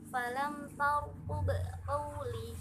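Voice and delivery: "tarqub" with the final b bounced, in slow recitation; bounced sukun. Shown as a demonstration. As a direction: let the b ending "tarqub" rebound briefly before "qawli". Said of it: "dipantulkan membacanya". (huruf b di akhir "tarqub" dipantulkan)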